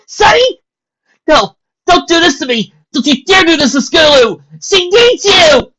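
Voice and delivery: high, shrieking plea; desperate screaming. Acted. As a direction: scream her not to die. Dash screams at dying Sunny.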